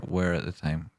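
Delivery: ordinary delivery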